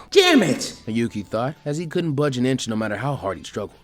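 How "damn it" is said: high, loud frustrated cry; angry curse. Acted. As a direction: spit out the curse. Trapped fighter curses.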